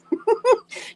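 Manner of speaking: giggle. Real, not acted. Laughs at own remark.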